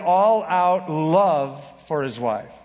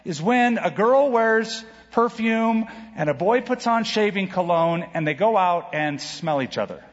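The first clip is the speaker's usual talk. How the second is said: put-on excited child; 4 semitones higher than usual. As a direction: mimic an eager little kid sharing a discovery. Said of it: "One kid said".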